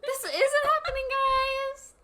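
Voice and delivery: high, loud, laughing mock despair; laughing shout. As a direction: wail it through laughter. Mock-quotes line, laughing.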